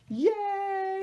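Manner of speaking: high, long drawn-out yay; happy cheer. Real, not acted. Crafter celebrating the collage masterboard, happy cheer. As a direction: cheer it in a high sustained note.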